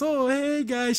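high, awkward imitated greeting; super embarrassed. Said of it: "she's, like, super embarrassed".

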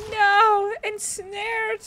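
high, loud dismayed yelp; dismayed cry. Real, not acted. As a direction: cry out as the trap hits. Character gets netted.

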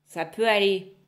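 loud grumbling witch; loud gruff witch grunt; grudging growl. Acted. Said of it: "Elle grogna".

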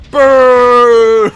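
loud, drawn-out boom; big vocal explosion; imitated explosion. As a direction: shout the blast yourself, gleefully long. Mimics mine explosion.

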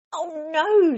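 very high, loud exasperated groan; fed up. A narrator's reading. Mister Bear woken by dawn, groaning loudly. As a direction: at the end of his tether. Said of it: "said Mister Bear".

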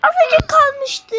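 very high, whining complaint; high whine, almost there; whiny lament. Real, not acted. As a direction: whine it like it's unfair. Lamenting a near win.

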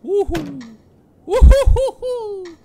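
high loud slow whoop; whooping cheer. Real, not acted. Gamer celebrating, loud high whoop. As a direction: howl with delight.

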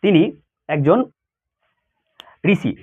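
usual speaking voice

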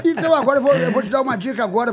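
loud, high sales pitch; over eager infomercial. Acted. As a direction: launch into the ad with gusto. Host switches into ad pitch.

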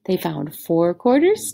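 plain reading voice

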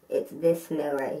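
muffled behind palm; speaking through hand. Real, not acted. Seen 0: palm covers nose and mouth area while speaking, mask on face.

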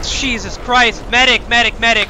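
yelling for a medic; urgent shout for a medic; man down shout. Real, not acted. Comrade wounded, calling medic.

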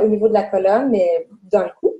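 normal talking voice

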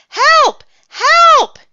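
high, loud cries for help; panicked yell from far away; shouting. A narrator's reading. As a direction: scream for rescue. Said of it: "he shouted".

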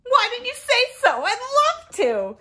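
high, loud, rushed delight; sudden eagerness. Acted. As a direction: burst out like a friend suddenly won over. Reluctant friend suddenly won over.

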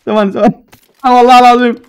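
loud, urgent gamer yell; excited shout. Real, not acted. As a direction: shout it in the heat of battle. Sudden firefight in the match. (صراخ متحمس)

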